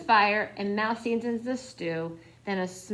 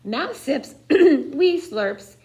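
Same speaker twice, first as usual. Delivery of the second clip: usual speaking voice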